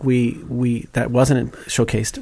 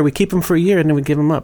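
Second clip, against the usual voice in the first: ordinary delivery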